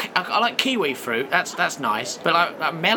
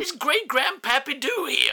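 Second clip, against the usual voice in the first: cranky ancient man; 10 semitones higher than usual. Acted. Ancestor introduces himself.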